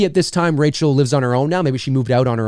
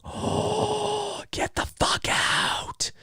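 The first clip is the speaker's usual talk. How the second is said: slow, breathless whispered disbelief; shocked whisper; 10 dB quieter, much slower than usual. Retold or imitated. Neighbor seeing the open front door, slow stunned whisper. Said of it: "It's like".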